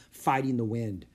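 usual speaking voice

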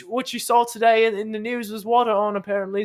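hammed-up high British accent, laughing through it. Host keeps up the British act for his cohost, mock British accent. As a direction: stay in the silly British voice.

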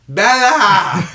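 high mock-desperate yell; hammed-up calling out. Imitating him outside her window, a dramatic high yell. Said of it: "He's literally outside of her window going".

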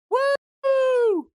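high-pitched drawn-out woo; a high, celebratory whoop. Real, not acted. Host kicking off the podcast with a high whoop.

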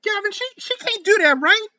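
the other woman yelling for help, high and frantic; high pleading woman's voice. A narrator's reading. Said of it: "Zakiya yelled".

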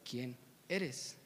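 very slow, low and soft; weighty, spaced words from a father above; solemn film quote. Acted. Mufasa to his son, slow, low, solemn. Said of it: "se vuelve a decir un montón de veces".

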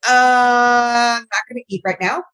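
startled cry, then a quick mutter; teacher thrown by a kid's news at breakfast, appalled. Retold or imitated. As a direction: lose your appetite mid-bite, half laughing. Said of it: "And I'm like".

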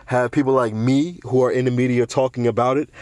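ordinary talk, nothing marked

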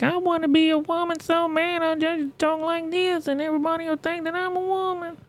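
mock a man straining for a woman's voice, way up high; mocking high-pitched voice. Streamer mocking a man's fake woman voice, high soft falsetto. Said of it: "really trying on that high-pitched voice".

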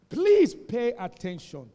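loud, slow, drawn-out command to listen; loud call for attention. Real, not acted. Pastor waking a drowsy congregation, slow and loud. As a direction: boom it out so the sleepers wake. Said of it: "Pay, what did I say?".

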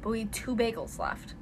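hushed whisper aside. Real, not acted. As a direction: drop to a whisper.

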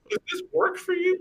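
high mock-polite offer; mock salesman. Imitating the drug dealer's offer, high mock service voice. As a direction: squeak it like a shop clerk.

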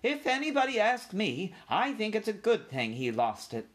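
put-on high old-aunt voice; elderly mouse aunt passing judgement; disapproving old aunt. A narrator's reading. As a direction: opine primly, starting high. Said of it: "said Aunt Dorothy".

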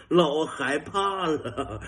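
ordinary talk, nothing marked

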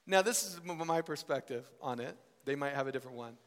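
ordinary talk, nothing marked

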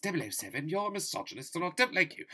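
high-pitched mock scolding; put-on voice of a disapproving spy boss; Judi Dench. Impression of Judi Dench scolding Bond.

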